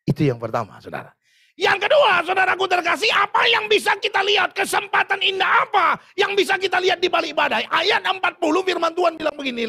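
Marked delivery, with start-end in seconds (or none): 1.6-9.7 loud, high preacher's shout; full-voiced sermon climax; preaching at full force. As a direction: thunder it at the congregation.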